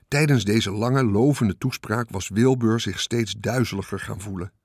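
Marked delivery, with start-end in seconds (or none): none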